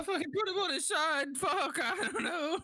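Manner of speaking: high, whimpering character panic; panicked wail. Acted. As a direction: cry out helplessly, in over your head. Player voices panic at friend's seizure.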